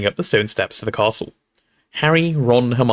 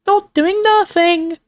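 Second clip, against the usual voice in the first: very high, slow impish voice; Peeves cackling; 3 dB louder, much slower than usual. A narrator's reading. Poltergeist defying the professor, cackling. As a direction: squeal it like a mischievous poltergeist. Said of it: "cackled Peeves".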